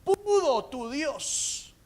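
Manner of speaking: high, very drawn-out shout; slow anguished holler; crying out. Acted. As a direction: shout the question, stretching it out. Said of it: "Y grita y dice".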